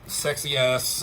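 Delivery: ordinary talk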